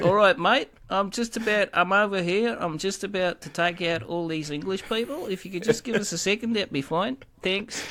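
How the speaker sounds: chirpy Scottish lad calmly excusing himself; casual Scottish accent. Retold or imitated. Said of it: "You should have just went".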